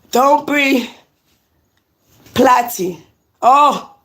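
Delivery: usual speaking voice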